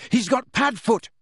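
desperate high shout. A narrator's reading. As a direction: call after him urgently. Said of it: "he shouted".

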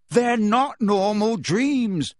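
very slow, high, loud shout; shouted in her face. Acted. Harry shouts right in Hermione's face, loud and slow. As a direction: hammer each word at her. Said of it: "Harry shouted in her face".

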